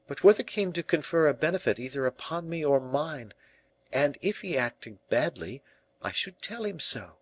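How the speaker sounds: high, earnest woman's voice; dignified La Valliere. A narrator's reading. La Valliere answers Colbert about the king, dignified. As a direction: set him straight, pitched high.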